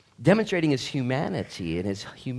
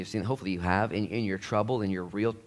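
ordinary delivery

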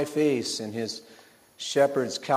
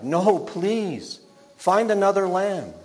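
put-on higher-pitched, upset boy's plea; pleading cry; 6 semitones higher, 3 dB louder, slower than usual. Boy begs his papa for another lamb, pleading cry. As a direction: beg Papa, crying out. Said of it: "I cried".

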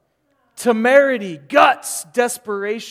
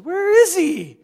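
much higher, louder cry; emphatic outcry; 11 semitones higher, 10 dB louder than usual. Real, not acted. Preacher searching for the nice Jesus, emphatic loud cry. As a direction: demand an answer, loudly. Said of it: "preacher's rhetorical outburst".

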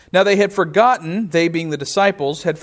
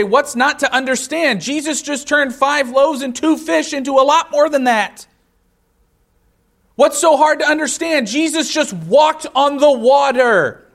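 much higher, louder, scoffing disbelief; mock incredulity; 9 semitones higher, 5 dB louder than usual. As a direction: play the smug skeptic who can't believe they missed it. Mocking modern reader's disbelief.

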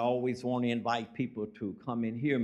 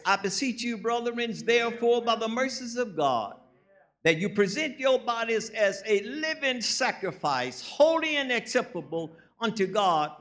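higher, ringing preacher's reading of the verse; preaching scripture; 7 semitones higher than usual. Acted. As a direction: proclaim the scripture to the congregation.

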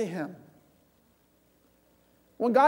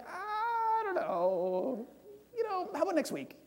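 higher, soft, drawn-out hesitant whine; reluctant stalling; 9 semitones higher, 7 dB quieter, slower than usual. Pretend. Believer dodging God's call, sheepish and higher. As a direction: stall sheepishly, then rush the excuse.

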